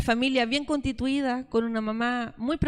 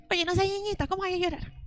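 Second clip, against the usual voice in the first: mock scolding; 5 semitones higher than usual. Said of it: "¿cómo le dicen a los niños?".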